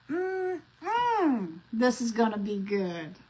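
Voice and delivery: eager relish. Shown as a demonstration. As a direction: say it like you really mean it. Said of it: "You gotta say it like you mean it".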